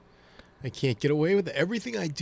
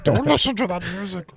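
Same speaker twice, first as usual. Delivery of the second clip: Yoda voice; 6 semitones higher, 6 dB louder than usual. Pretend. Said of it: "you had the voice okay".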